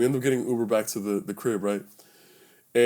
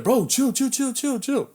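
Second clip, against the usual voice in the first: high, rapid string of pleas; frantic peacemaker; retold pleading; 13 semitones higher than usual. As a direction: act out talking a hothead down, pitched up. Calming friend in retold fight.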